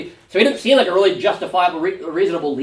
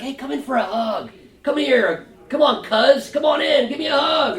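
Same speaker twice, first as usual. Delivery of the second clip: high, calling out a mock-warm hug invite; fake friendly welcome; 8 semitones higher than usual. Joab calling his cousin in for a hug, fake-friendly. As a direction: beckon him in like family.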